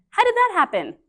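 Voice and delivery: teacher feigning amazement; mock surprise. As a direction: act amazed at the class.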